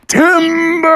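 high, drawn-out holler; calling out. Acted. Chopped tree announces its fall, calling out. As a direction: holler it long as the tree topples.

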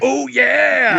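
big high drawn-out shout; Kool-Aid Man. Acted. Mascot crashing through a door, Kool-Aid Man yell. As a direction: burst in with a booming, gleeful catchphrase. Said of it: "it's also very Kool-Aid Man".

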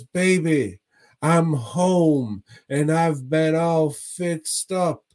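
high, slow, sing-out husband greeting; mock homecoming call. Voicing old man's homecoming in retelling.

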